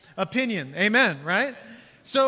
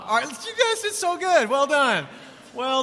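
excited praise; 6 semitones higher, 5 dB louder than usual. Real, not acted. Praising congregation's responses.

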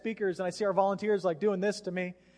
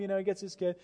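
normal talking voice

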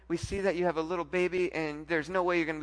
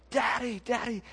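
retold child's scream; 7 semitones higher than usual. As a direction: imitate a child crying out for his father. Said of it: "my son's just screaming over his shoulder".

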